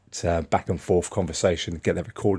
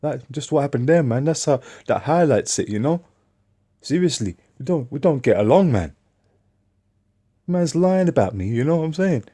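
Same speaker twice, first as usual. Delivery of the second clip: lightly voiced coworker, higher and emphatic; imitating his coworker; 8 semitones higher than usual. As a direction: show how the guy talks, a touch higher. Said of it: "That's kind of how he talks".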